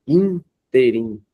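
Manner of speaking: "Interim" very slow, first syllable held long; stressed syllable stretched. Shown as a demonstration. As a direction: stretch the opening "In" of "Interim", then pause. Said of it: "Temos um aqui também mais forte". ("Interim" devagar, alongando a primeira sílaba)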